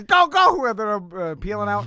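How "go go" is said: sharp high call to go; urgent shout. Retold or imitated. Retold friend urging him to go.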